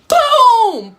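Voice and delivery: high, loud laughing boom; loud punch sound. Retold or imitated. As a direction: blast the impact word with a laugh. Punch hits in the story.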